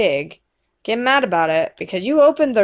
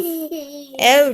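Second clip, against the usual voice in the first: ordinary delivery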